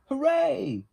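high, loud cheer; joyful shout. Acted. The friends cheer together, loud high shout. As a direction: throw it up high and loud in joy. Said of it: "shouted Little Beaver and the duck".

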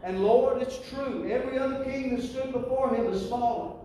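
high, loud fervent plea; fervent prayer. Retold or imitated. Hezekiah crying out to God in the temple, high and loud. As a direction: cry it out to the Lord. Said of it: "He said".

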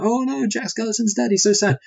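high, hammed-up fake wail over "dead"; mock crying mayor. Mayor hams up fake tears over Jack, squealing high. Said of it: "he's crying".

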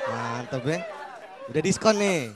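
ordinary talk

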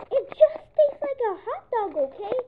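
high, loud frustrated yell; loud, high and fed up; exasperated outburst. Acted. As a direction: snap at the pestering duckling. Pigeon losing patience.

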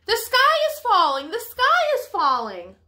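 high excited shout. Acted. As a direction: shout it out in the conga line. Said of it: "They shouted".

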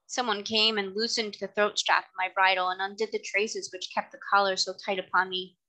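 ordinary delivery, nothing marked

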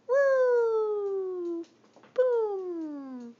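loud high whoop; excited cheer. Real, not acted. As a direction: cheer it out big.

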